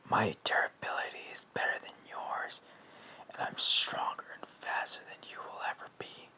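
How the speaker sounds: whispered, rasping symbiote boast; Carnage. Acted. Carnage boasting to Venom in his head, whispered creature voice. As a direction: hiss it out softly like a menacing monster. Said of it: "Sorry, I do the Venom voice".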